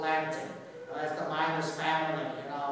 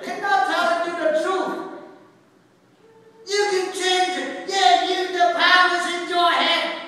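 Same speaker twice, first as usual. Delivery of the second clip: loud, higher-pitched sermon delivery; impassioned preaching; 9 semitones higher, 7 dB louder than usual. Real, not acted. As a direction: preach it out to the whole room. Preaches at full force.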